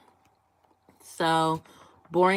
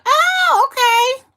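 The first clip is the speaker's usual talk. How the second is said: much higher, louder surprised squeal; surprised oh okay; 10 dB louder, much slower than usual. Real, not acted. Viewer born in Chicago surprises her, high surprised squeal. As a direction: caught off guard, squeal it out.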